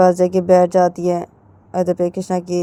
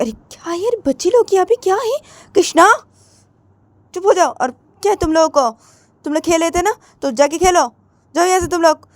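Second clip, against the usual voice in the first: much higher, sharp shooing voice; bossy elder sister; 11 semitones higher, 3 dB louder than usual. Acted. Elder sister shooing the children away, high and sharp. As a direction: shoo the kids off, impatient.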